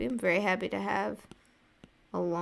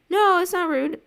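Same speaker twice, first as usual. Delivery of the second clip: much higher and louder, quick to reassure; eager reassurance; 13 semitones higher, 9 dB louder than usual. Real, not acted. Reassuring a viewer who apologized for rudeness, high and loud. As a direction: jump in to wave off the apology.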